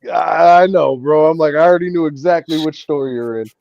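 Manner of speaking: telling him he knew all along; emphatic. Retold or imitated. Retold smug reply.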